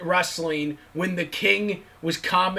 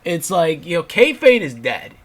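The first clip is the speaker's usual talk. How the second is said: normal talking voice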